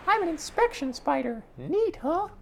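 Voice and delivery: very high, squeaky little voice; little spider introducing itself, very high; tiny spider voice. Acted. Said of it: "I can't do that voice for too long".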